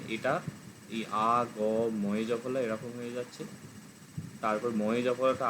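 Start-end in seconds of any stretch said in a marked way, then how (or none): none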